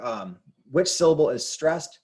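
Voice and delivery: ordinary delivery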